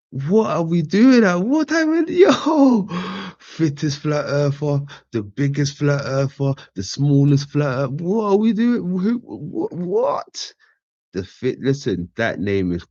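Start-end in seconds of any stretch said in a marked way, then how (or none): 0.1-10.5 high, loud, squeaky disbelief; climbing into a laughing squeal; giggling can't-believe-it rant; incredulous laughing. Real, not acted.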